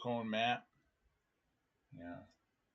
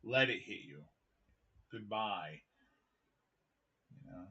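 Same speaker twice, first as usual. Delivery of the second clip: normal talking voice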